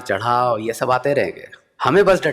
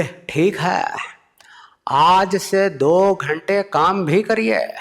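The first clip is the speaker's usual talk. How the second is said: whispered start, then very slow higher orator voice; Modi impression; 6 semitones higher, much slower than usual. Modi slowly ordering the comedian to work. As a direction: dole out the order like a lofty speech. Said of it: "वो बोले".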